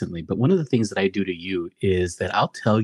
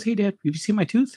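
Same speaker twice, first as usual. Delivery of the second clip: a hint of a child's voice, pitched up; lightly voiced son; 11 semitones higher than usual. Son asks Dad about his lost tooth, lightly imitated child voice. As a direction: imitate a kid calling Dad, higher pitched. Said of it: "My son comes down and he goes".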